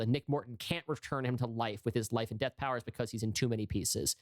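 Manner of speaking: normal talking voice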